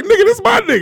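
high, pleading protest. Retold or imitated. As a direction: stick up for your friend. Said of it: "Spanky was like".

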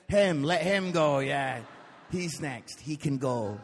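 loud low group urging; mock-insistent, punched out; imitating anxious men. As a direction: loudly push him to the front. Men urgently pass on the barber.

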